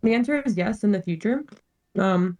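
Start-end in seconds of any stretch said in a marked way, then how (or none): 2.0-2.4 chewing. Real, not acted. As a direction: mouth full while answering. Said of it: "sorry, chewing again".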